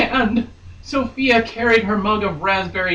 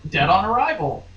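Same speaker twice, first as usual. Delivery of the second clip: ordinary talk, nothing marked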